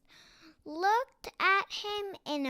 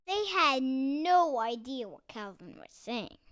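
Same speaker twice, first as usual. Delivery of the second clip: usual speaking voice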